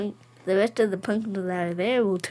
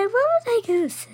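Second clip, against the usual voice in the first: normal talking voice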